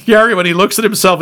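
ordinary talk, nothing marked

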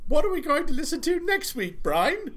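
high, squeaky cartoon sidekick; Pinky voice. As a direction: chirp it like a cartoon mouse. Pinky and the Brain quote.

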